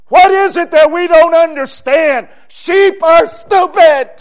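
high, loud, hammered preaching shout; full-force preaching. Real, not acted. Preacher answering an objection to the congregation, full-force preaching. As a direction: pound out each phrase at full voice.

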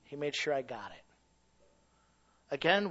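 ordinary delivery

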